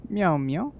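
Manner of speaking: high cutesy "meow, meow"; a light mock cat voice. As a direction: meow like a playful kitten. Cat noises in chat.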